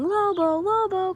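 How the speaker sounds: high, loud call of the name; calling the dog. Retold or imitated. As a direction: shout for a lost dog. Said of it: "I'm running around and I'm screaming".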